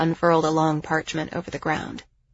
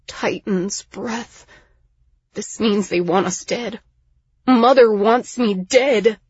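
distraught realisation; 4 semitones higher, 4 dB louder, slower than usual. Acted. Learns mother ordered his execution.

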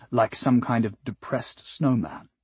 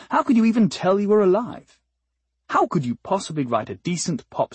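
exasperated; 6 semitones higher, faster than usual. A narrator's reading. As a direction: throw the question up in disbelief. Exasperated rhetorical question.

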